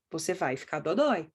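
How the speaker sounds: low, falling verdict on "dodói"; what the child hears. Shown as a demonstration. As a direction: drop the voice and state "vai ficar" plainly. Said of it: "O que que você tá dizendo pra criança?".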